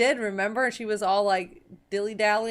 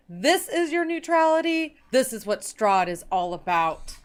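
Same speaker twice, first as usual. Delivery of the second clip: loud acted confrontation, pitched up at the start; indignant outburst; 5 semitones higher, 7 dB louder than usual. Katara confronts Grimrock over his neutrality, indignant and loud.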